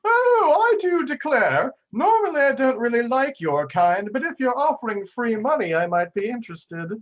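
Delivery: high, loud, theatrical madam voice; Madame Scowl. Acted. Madame Scowl answers an offer of free money, high haughty voice. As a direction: declare it grandly in a high falsetto. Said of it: "Madame Scowl was turning around and she says".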